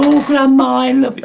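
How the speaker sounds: hammed-up sugary falsetto; mock sentimental. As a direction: coo it like a soppy grandchild, mocking. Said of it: "and you have to go".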